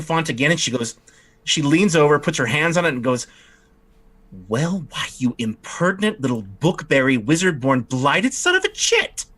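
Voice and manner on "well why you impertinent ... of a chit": witch cursing. Acted. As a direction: spit the insults at the absent wizard. Witch curses the wizard.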